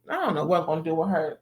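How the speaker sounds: fast, tossed-off joke; joking aside. Retold or imitated. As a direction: laugh it off quickly. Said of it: "joking with Maine".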